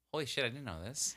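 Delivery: mock stunned. Imitating Tony hearing news, hushed and amazed. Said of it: "And Tony's like".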